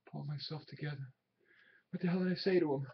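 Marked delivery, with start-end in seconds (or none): 0.1-1.0 "pull myself together" echoed much softer, almost to himself; bitter murmur. Acted. Willie alone, echoing his boss's words in a hurt murmur.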